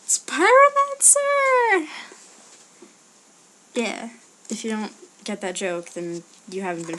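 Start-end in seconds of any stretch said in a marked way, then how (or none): 0.0-1.9 very high, loud, stretched-out yell; high drawn-out shout. Pretend. Vlogger yelling an in-joke for followers, high drawn-out shout. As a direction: cry out an in-joke catchphrase, long and high.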